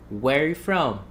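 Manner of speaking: "Where you from?" linked with "are" gone; reduced, as heard. Shown as a demonstration. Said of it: "when you listen, it's like".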